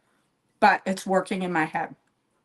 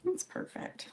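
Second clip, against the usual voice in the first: whispering; 14 dB quieter, slower than usual. Real, not acted. Whispered aside.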